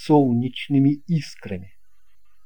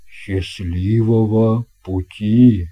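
lower, slow grown-up captain; captain's reply; 6 semitones lower, 4 dB louder, slower than usual. A narrator's reading. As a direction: answer slowly as the old captain. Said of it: "откликнулся капитан".

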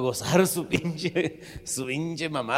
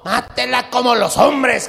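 loud, slow, emphatic order; firm advice; 7 semitones higher, 7 dB louder, slower than usual. Acted. Compadre ordering his friend, loud and slow. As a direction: lay down the law.

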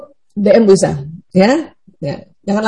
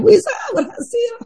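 high, soft little report of success; mock happy DM; 13 semitones higher, 5 dB quieter than usual. A student messaging Bu Isa about her success, high and soft. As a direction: chirp it like an excited student. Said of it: "D M Bu Isa ya".

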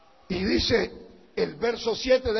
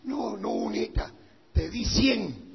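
the Lord refusing; 2 semitones lower, 8 dB quieter than usual. Acted. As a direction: quietly turn down the plea as God. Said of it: "Y el Señor le dice".

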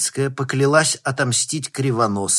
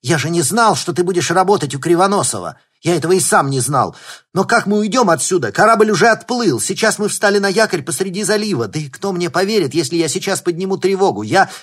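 fast, high, loud panicky talk; panicked; 9 semitones higher, 10 dB louder, faster than usual. Acted. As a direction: rattle it off in alarm.

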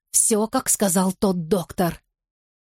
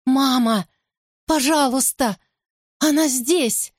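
tearful plea; 6 semitones higher than usual. A narrator's reading. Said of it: "Слёзы щиплют глаза".

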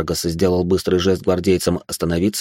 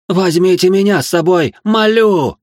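much higher and louder desperate howl; wailing plea; 12 semitones higher, 6 dB louder, slower than usual. Acted. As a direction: beg for her life, wailing. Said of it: "взвыла Абелот".

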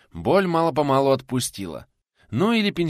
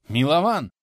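«Милован!» louder and higher; insistent call; 4 semitones higher, 5 dB louder than usual. A narrator's reading. Old man calling Милован a second time, insistent call.

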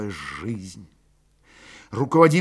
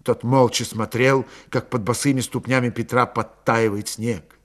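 ordinary delivery, nothing marked

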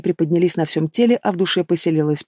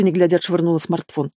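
ordinary delivery, nothing marked

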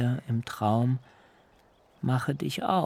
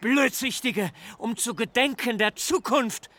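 put-on furious old father; 7 semitones higher than usual. A narrator's reading. Jakob berates his sons for the bloodshed, high and anguished. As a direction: cry out at them in despair.

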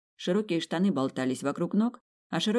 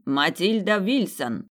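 slow, louder formal proclamation; booming announcement; 3 semitones higher, 4 dB louder, slower than usual. Acted. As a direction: announce the guest so the hall hears. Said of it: "громогласно представил он".

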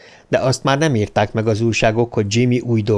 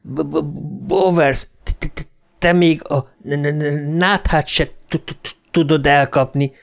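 higher voice, stammering hard on first consonants; stuttering ghost boy; 4 semitones higher than usual. A narrator's reading. A ghost boy mocking Bowers at Juniper Hill, stuttering. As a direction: taunt him through a heavy, blocked stutter.

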